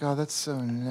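relaxed sigh. Retold or imitated. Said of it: "I'm just like".

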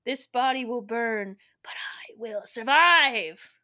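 slowing to a theatrical rasping whisper-shout; hammed-up villain climax. Villain vowing to survive the blast, hammed-up slow climax. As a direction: stretch out the last words dramatically.

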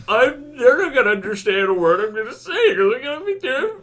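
crying voice. Retold or imitated. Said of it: "We're like".

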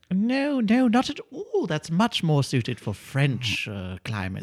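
put-on high, sweet old lady voice. Old lady happily answering the burglar, high sweet voice. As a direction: play a doting old lady.

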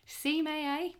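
high smug bragging; smug boast. Retold or imitated. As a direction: brag like you called it. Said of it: "I was like".

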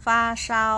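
fāshāo held high and loud; high, level tones on fāshāo for repeating; model reading of fāshāo. Shown as a demonstration. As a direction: say fāshāo out clearly for learners to copy. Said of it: "ฝึกอ่านตามเหล่าซื้อด้วยก็ได้นะคะ".

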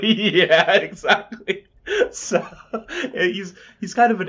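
laughing through the words; high, loud laughing talk. Real, not acted. Laughing at his character bit.

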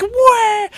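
very high cartoon "wah"; mock wail. Demon holding her own severed head, high mock wail. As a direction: squeal out a fake cry.

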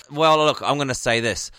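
normal talking voice

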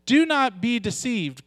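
usual speaking voice

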